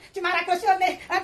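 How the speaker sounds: usual speaking voice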